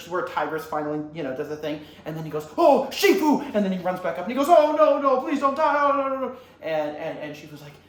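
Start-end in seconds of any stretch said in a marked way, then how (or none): 2.6-3.4 high, loud call to the master; hammed-up distress; acted alarmed cry. Po calls to fallen master. 4.5-6.4 high, loud, wailing begging; hammed-up grief panic; acted frantic pleading. Po pleads with dying master.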